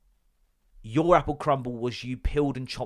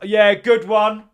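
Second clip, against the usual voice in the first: sarcastic; 8 semitones higher, 11 dB louder, slower than usual. Retold or imitated. Voicing Bill's sarcastic reply.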